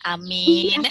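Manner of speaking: normal talking voice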